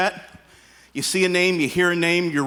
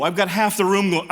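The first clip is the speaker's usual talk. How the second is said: ordinary talk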